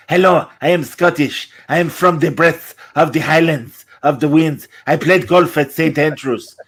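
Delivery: mock French-accented Scotsman; Christopher Lambert. French actor playing a Highlander, Lambert's French accent. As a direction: claim Scottishness in a badly placed French accent. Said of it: "Yes, Christopher Lambert".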